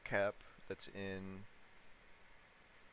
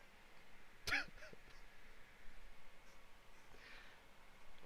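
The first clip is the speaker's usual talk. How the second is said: high squealing laugh; 8 dB quieter than usual. Real, not acted. Laughing at his own idea.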